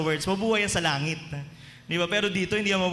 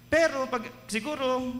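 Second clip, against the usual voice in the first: higher, hesitant put-on voice; doubtful tone; 6 semitones higher than usual. Martha answering Jesus half-doubting, higher hesitant voice. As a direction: sound unsure while claiming faith. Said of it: "ganito siguro yung tono niya".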